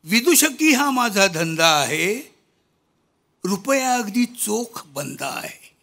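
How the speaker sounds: loud, slow declaimed verse; poem recitation. Acted. (कविता वाचन, मोठ्या आवाजात संथपणे)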